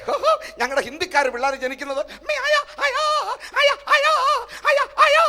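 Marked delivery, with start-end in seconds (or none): 2.3-5.2 put-on very high, loud wailing baby voice; crying baby. Pastor imitating a Hindi newborn crying, very high and loud. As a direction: wail each word like a newborn.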